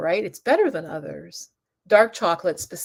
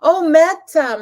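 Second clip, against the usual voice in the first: sudden recognition; 10 semitones higher, 11 dB louder, slower than usual. Retold or imitated. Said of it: "And I'm like".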